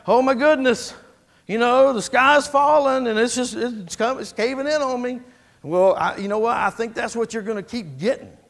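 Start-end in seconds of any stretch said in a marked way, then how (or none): 0.1-5.2 higher, louder mock alarm; overwrought worrier. Preacher voices someone fixated on trouble, overwrought. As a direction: lament as if everything is collapsing. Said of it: "If you put your emphasis on that".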